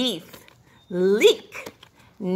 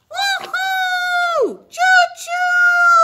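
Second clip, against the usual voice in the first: high shouted train call; train whistle cheer; 3 dB louder, much slower than usual. Acted. Train ride cheer for kids.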